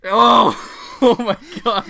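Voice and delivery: loud, high burst of laughter into oh my God; laughing out loud. Real, not acted. Cohost hearing the bad film list, laughing loudly. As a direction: crack up at the absurd list.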